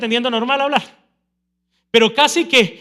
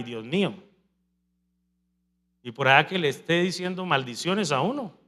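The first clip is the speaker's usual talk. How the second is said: ordinary talk, nothing marked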